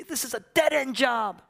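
high-pitched cry of complaint; mock wail of a fed-up worker. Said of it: "Why do we cry out when we're working?".

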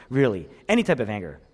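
usual speaking voice

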